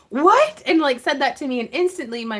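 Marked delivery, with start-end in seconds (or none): 0.1-0.6 high, loud startled What?; surprised what. Retold or imitated. Husband's shocked reply to a misheard line, surprised What?. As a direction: blurt it out in shock. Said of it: "he was like".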